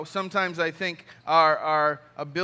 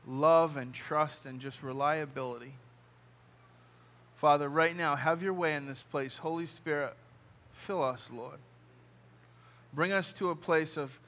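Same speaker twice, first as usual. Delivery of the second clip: closing prayer; 3 semitones lower, 3 dB quieter than usual. Acted. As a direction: pray gently over the room. Closing prayer over congregation.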